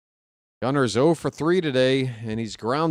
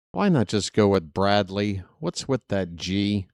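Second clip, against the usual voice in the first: usual speaking voice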